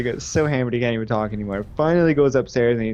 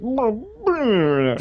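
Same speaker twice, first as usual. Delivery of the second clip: put-on high, wordless angry drunk whine; angry drunk mumbling; 11 semitones higher than usual. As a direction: imitate a drunk protesting without real words. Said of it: "he's angry".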